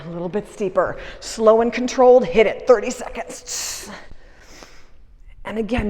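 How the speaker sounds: ordinary talk, nothing marked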